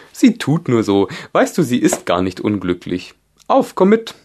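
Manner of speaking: loud, high creature voice; brisk gryphon urging on. A narrator's reading. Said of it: "fast wörtlich wie vorhin". (Greifenstimme, laut und drängend)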